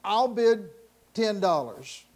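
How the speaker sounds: usual speaking voice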